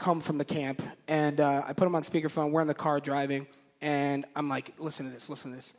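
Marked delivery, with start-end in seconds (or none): 4.8-5.7 low, soft urging; hushed aside. Retold or imitated. Husband to wife before speakerphone, hushed. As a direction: tip her off under your voice.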